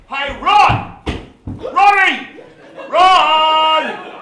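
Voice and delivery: shouting to a teammate over the crowd; calling out. Acted. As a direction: yell for your mate Rod. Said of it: "I called out to my mate Rod".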